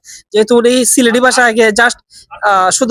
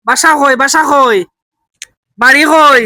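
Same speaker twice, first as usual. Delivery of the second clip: asking in Sylheti dialect, raised and slow-paced for a stranger; 3 semitones higher, slower than usual. Shown as a demonstration. To a lost old man, asking where home is, in Sylheti dialect. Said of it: "Sylheti ভাষা try করে দেখি".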